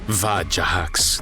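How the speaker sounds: ordinary delivery, nothing marked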